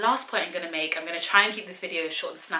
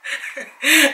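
laugh; 8 semitones higher, 13 dB louder than usual. Real, not acted. Laughing at her own joke to viewers. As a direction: burst of real laughter.

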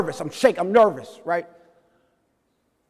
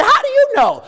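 high, loud disbelieving question; incredulous; 6 dB louder than usual. Retold or imitated. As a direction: blurt it out in squeaky astonishment. Acted disbelief at mentor's praise.

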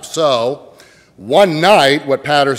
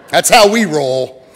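louder, higher playful quip; playful punchline; 5 semitones higher, 6 dB louder than usual. Real, not acted. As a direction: tease the congregation with it. Repeated punchline.

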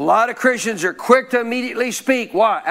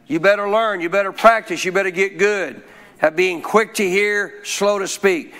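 usual speaking voice

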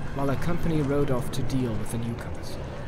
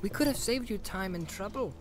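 higher, soft careful hobbit voice; softer, higher, measured hobbit bargaining; 5 semitones higher, 9 dB quieter than usual. Acted. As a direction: pick each word carefully, softly. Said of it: "choosing his words carefully".

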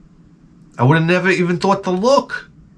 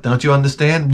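ordinary talk

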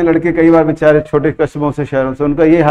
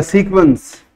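usual speaking voice

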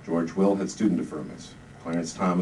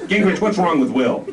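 high, loud and baffled; lieutenant asking Gingrich about Will, exasperated; 9 semitones higher, 5 dB louder than usual. Acted. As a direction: throw up your hands.

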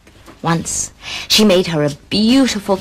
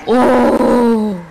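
delighted gasp; 3 semitones higher, 4 dB louder than usual. A narrator's reading. Narrator gasps at beauty.

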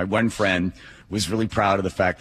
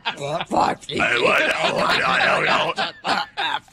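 long airy laugh; wheezing laughter; breathy laugh; 7 semitones higher than usual. Real, not acted. Big laugh at joke.

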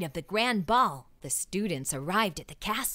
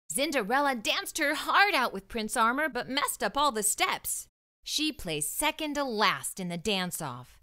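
ordinary delivery, nothing marked

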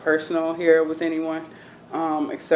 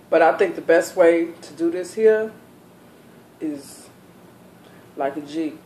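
normal talking voice

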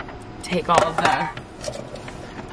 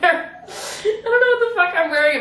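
much higher, laughing almost to tears; high laughing cry; 11 semitones higher than usual. Real, not acted. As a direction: let it crack into helpless laughter, way up high. Laughing at her outfit.

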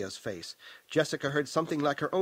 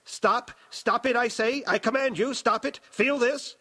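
high, frantic raging command; raging old Baron; 9 semitones higher, 4 dB louder than usual. A narrator's reading. Old Baron inside Aaliyah commanding her to stop, raging. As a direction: rage like a tyrant losing control. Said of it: "the voice of the old Baron raged".

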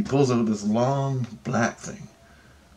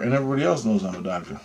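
normal talking voice

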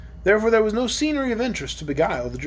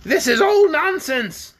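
slow, higher and louder irritation; irritated; 9 semitones higher, 5 dB louder, slower than usual. Acted. Queen cutting off the quarrel, irritated, higher and louder. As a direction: snap it off, fed up. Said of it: "said the Queen with irritation".

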